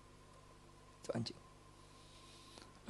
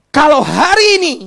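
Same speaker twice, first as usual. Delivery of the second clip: loud burst; 10 semitones higher, 16 dB louder than usual. Acted.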